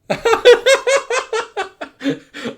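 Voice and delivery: very high, loud laughing fit; hearty laugh. Real, not acted. Streamer bursts out laughing at the narrator's dry remark. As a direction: let the laugh explode.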